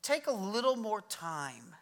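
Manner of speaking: ordinary delivery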